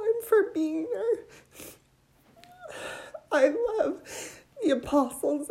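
pushing through tears of gratitude; choked up, crying while thanking them; tearful. Real, not acted. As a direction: voice breaking with real tears. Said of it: "I'm so sorry I'm so emotional".

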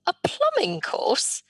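high, slow disbelieving echo; incredulous. Retold or imitated. Dismayed at dad's suggestion.

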